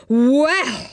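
a touch of rising temper. A narrator's reading. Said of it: "his temper rising".

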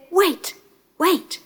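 high, urgent interjection; calling a halt. Acted. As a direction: cut in to stop a fight. Princess stops animals about to fight.

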